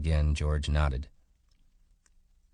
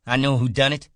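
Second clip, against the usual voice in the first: loud, much higher outburst; crying out; 10 semitones higher, 9 dB louder, faster than usual. A narrator's reading. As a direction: blurt it out loud, sudden and wild. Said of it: "he cried".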